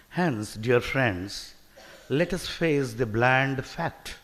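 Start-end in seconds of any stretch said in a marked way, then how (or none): none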